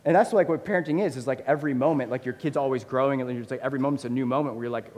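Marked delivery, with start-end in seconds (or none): none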